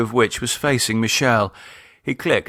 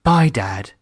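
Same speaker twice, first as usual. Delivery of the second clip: pointed; 3 dB louder than usual. Acted. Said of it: "saying pointedly".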